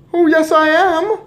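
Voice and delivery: very high, loud squeaky voice; mouse boy. A narrator's reading. Mouse boy corrects his father, squeaking loudly. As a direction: pipe up like a little mouse.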